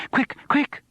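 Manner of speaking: high, urgent cry of alarm. A narrator's reading. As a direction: call out in a panic, high. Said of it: "she cried".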